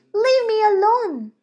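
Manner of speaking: high, loud shout; boy shouting. Acted. Boy shouts at the girl to leave him alone. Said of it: "the boy shouts at her".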